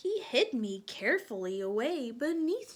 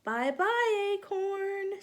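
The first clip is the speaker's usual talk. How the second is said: high, louder sing-song farewell; high leaf voices; 10 semitones higher, 6 dB louder, slower than usual. Acted. Leaves calling farewell to the acorn. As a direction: wave goodbye up high. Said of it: "said the leaves".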